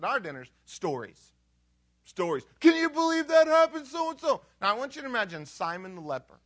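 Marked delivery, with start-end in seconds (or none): 2.6-4.4 high, loud dinner-table exclamation; excited storytelling. Pretend. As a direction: burst out with an amazing tale, pitched way up.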